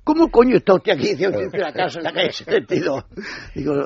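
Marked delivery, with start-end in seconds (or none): 0.1-1.0 higher and louder, hammed-up indignation; imitating his own retort to the rude neighbour; mock exasperated retort. 1.2-3.0 laughing retort. Real, not acted. Retold retort while laughing.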